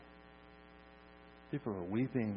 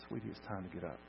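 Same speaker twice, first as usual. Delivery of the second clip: soft, low, tender murmur; gentle waking; 4 semitones lower, 10 dB quieter, faster than usual. Acted. Parent waking a child from a nap, gently. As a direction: rouse a napping child very softly. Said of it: "real gently".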